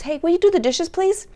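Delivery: sweet pleading ask. Retold or imitated. Parent sweetly begging teen to do dishes, high pitched. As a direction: coax them nicely, lifting high on please. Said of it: "now you're asking".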